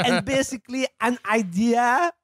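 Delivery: mock French accent, slow and high. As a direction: ham up a French accent reading the quote. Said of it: "I'm not gonna do the French accent anymore".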